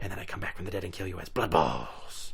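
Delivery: breathy seductive whisper; unvoiced, husky whisper. Whispered joke.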